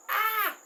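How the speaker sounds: a sudden loud high "Ah!"; startled cry out; startled high yelp. Real, not acted. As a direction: yelp as if something just fell. Sudden startle while packing up.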